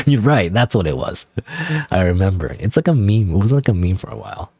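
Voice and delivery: normal talking voice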